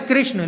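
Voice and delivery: "कृष्ण" as krishna, with ri; correct pronunciation. Shown as a demonstration. Teacher naming the real word, "कृष्ण" as krishna. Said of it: "शब्द क्या है?".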